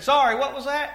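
mock hard-of-hearing question. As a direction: ask it as if straining over noise. Retold driver who couldn't hear.

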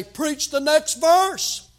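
loud, high, drawn-out exhortation; emphatic retort. Retold or imitated. Pastor answering preachers who don't know what to preach, emphatic retort. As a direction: belt it out slowly and high.

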